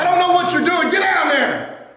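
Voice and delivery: bully him to the floor; barked orders. Acted. Boss ordering worker down.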